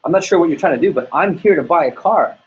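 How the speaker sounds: rising irritation, lightly played; a retold, mildly annoyed insistence; irritated. Friend insisting to the salesman, mildly irritated. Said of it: "irritated, said".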